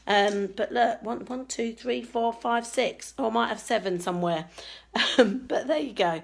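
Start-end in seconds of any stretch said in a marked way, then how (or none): none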